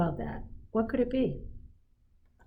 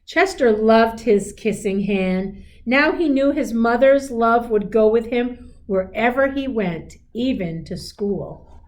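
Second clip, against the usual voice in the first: gentle storybook read-aloud; a teacher reading a picture book aloud; reading to children; 2 semitones higher, 6 dB louder than usual. A narrator's reading. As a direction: read it like a bedtime story to a class.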